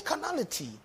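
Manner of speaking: high call-and-response. Shown as a demonstration. Pastor leading the congregation, high call. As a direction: sing out the word for them to echo.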